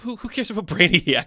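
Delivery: high, loud, laughing question; laughing dismissal. Real, not acted. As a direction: laugh it off mid-sentence, pitch up. Laughs off confusion over Brainiacs.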